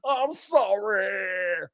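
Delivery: slow, drawn-out fake weepy sorry; mock tearful sorry. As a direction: stretch it out with fake tears. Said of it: "voice is a little shaky".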